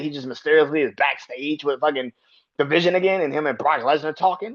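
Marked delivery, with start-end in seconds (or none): none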